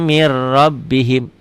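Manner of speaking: very slow "mir rabbihim" with the r held; slow demo. Shown as a demonstration. Teacher drawls the merge so students can follow. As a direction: stretch "mir rabbihim" slowly, no nasal hum. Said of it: "Hudam agak sedikit dengung dan ditahan".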